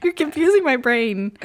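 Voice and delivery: high, loud laughing protest; playful protest. Real, not acted. Friend teases her with accents, high loud laughing protest. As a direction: cry out in mock exasperation. Co-host teasing with German.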